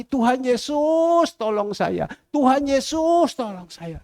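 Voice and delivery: slow, drawn-out calls for help; pleading prayer. Retold or imitated. Praying for eternal healing, pleading. Acted prayer crying to Jesus.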